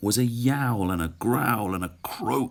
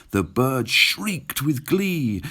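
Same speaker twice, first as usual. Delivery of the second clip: plain reading voice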